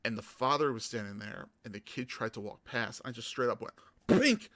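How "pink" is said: high, loud mock impact noise; cartoon smack cry; hit sound effect. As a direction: yelp the hit as a comic sound. Sound of elbow hitting kid.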